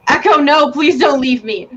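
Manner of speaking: a griffin crying out as her rider dies; anguished cry not to be left; desperate plea. Acted. Griffin begs dying girl to stay.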